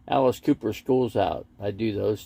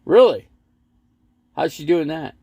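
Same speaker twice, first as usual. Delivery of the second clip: surprised; 6 semitones higher, 7 dB louder than usual. Real, not acted. Reacts to chat news.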